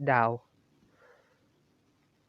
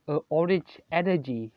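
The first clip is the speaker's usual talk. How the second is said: ordinary delivery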